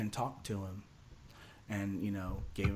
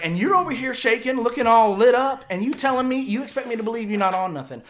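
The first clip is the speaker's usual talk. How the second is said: loud, high accusing rant; angry retelling; 12 semitones higher, 14 dB louder than usual. Retold or imitated. Buddy accusing him of being high, angry. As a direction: act out a buddy yelling in disbelief.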